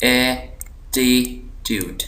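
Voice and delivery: Attitude very slow, three separate syllables. Shown as a demonstration. Teacher dividing the word for students, slow split syllables. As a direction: break attitude into ए, टी, ट्यूड with pauses. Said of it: "यहाँ पर ज़ोर दिया जा रहा है".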